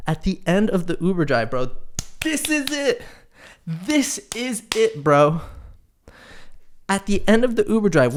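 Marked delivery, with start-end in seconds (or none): 2.2-3.0 higher put-on voice of the driver; a hint of an eager driver; imitating the driver. Driver's words at end of ride. 3.6-5.4 drawn-out slow version of "This is it"; slowed, put-on driver voice; slow mock driver. Driver's words repeated.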